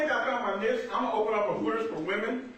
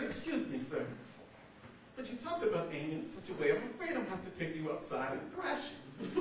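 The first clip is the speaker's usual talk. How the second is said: softer, prim mock British accent; uppity British accent; 4 semitones lower, 10 dB quieter, faster than usual. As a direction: put on a polite, uppity British voice. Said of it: "that uppity British accent".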